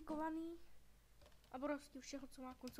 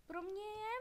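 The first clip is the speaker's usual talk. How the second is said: normal talking voice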